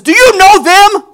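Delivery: high, slow, very loud demand; furious shout. Retold or imitated. Husband looming over wife about strangers, furious shout. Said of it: "he says to her, ... Like that".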